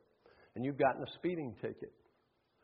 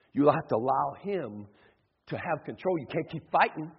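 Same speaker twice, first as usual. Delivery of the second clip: ordinary talk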